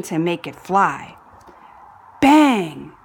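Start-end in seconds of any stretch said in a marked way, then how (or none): 2.2-2.9 dramatic bang. A narrator's reading. Third rocket explodes.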